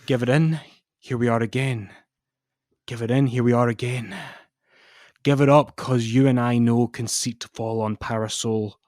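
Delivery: swinging between loud declaiming and near whisper; hammed-up dramatic reading of wrestling lyrics. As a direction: read the lyrics like a dramatic poem. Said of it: "do a dramatic reading of the lyrics".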